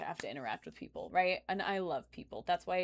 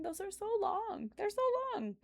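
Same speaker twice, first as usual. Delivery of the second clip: much higher, whiny stretch on so; exaggerated high complaint; 13 semitones higher than usual. Real, not acted. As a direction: pitch it way up in mock exasperation. Repeating how long graduation was.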